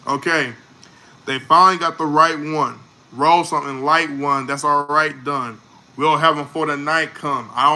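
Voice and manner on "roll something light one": ordinary talk, nothing marked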